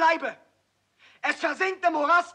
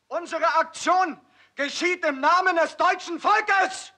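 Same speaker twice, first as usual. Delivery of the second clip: ordinary talk, nothing marked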